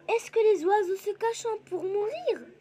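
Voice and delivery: high question tune with a peak on "mourir"; "mourir" soaring then falling at the end; questioning reading. Shown as a demonstration. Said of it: "point d'interrogation".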